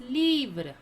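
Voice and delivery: "Livre" said as "LEEVR", falling; pronunciation demo. Shown as a demonstration. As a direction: model French "Livre" with long ee and light final vr. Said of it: "How to pronounce?".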